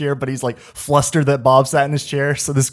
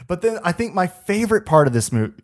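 ordinary talk, nothing marked

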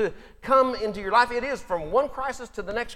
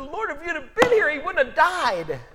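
grieving reproach; 8 semitones higher, 6 dB louder than usual. Acted. Mary runs out to Jesus.

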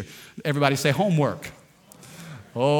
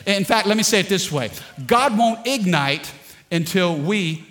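louder, higher preaching push; 4 semitones higher, 4 dB louder than usual. Acted. Pastor driving home his point to the congregation, preaching. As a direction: build the point like a pulpit climax.

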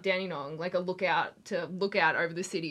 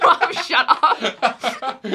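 slow, laughing brush-off; 4 semitones higher, 8 dB louder, much slower than usual. Retold or imitated. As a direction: laugh it off at your mum. Teen snapping at her mum.

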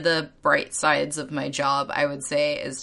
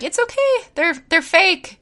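put-on much higher, soothing voice for a scared kid; reassuring a child; 13 semitones higher than usual. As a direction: coax a nervous little girl, sweet and high. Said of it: "and I was like".

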